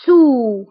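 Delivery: سو said as French sous, clear o; teacher modelling سو as French sous; French word model. Shown as a demonstration. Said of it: "إكزامبل".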